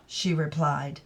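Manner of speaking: ordinary delivery, nothing marked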